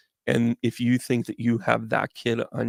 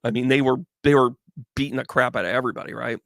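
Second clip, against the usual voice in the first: usual speaking voice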